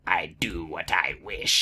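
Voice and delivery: low, slow sinister emperor; Palpatine impression. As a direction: growl it like a haughty dark lord. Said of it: "order Palpatine around".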